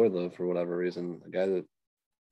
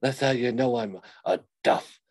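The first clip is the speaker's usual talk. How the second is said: louder, higher hammed-up tough-guy boast; mock tough guy; 6 semitones higher, 5 dB louder than usual. Mocking the wrestler boasting about his rosary, hammed-up. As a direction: brag about the rosary like a fake gangster.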